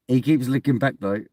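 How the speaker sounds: ordinary delivery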